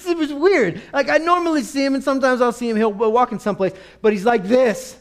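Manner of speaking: high, loud, bewildered rambling; baffled neighbour. Acted. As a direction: marvel loudly at the oddity. Neighbour describes the changed man.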